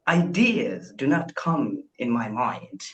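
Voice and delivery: usual speaking voice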